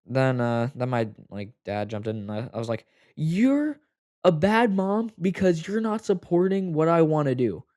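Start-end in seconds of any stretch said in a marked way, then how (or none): 3.2-7.6 high, loud accusing shout; retold yell at mom. Teen screaming at his mom in front of dad, furious. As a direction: hurl it at her like an accusation. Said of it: "like, loud".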